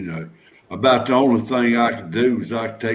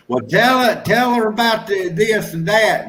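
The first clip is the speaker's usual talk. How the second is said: higher-pitched mock wife urging him on; imitated wife; 9 semitones higher than usual. Husband quoting his wife about the doctor, higher mock wife voice. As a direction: put on a higher voice for a prodding wife. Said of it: "my wife will say".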